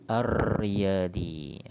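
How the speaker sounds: "Ar-Riyadi" very slow and loud, long trilled R; silent lam. Shown as a demonstration. Teacher testing students' ears, "Ar-Riyadi" with long R and no L. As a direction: hold the doubled R in "Ar-Riyadi", no L. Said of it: "Bunyi tak lam dia?".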